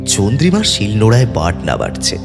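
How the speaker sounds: usual speaking voice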